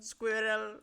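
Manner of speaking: Squirrel pitched high and loud; distinctive "squirrel". Real, not acted. Player repeating the word to teasing friend, Squirrel high and loud. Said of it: "the way you say squirrel".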